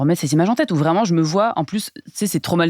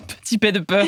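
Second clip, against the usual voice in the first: laughing; 4 semitones higher than usual. Real, not acted. Laughing at her own story.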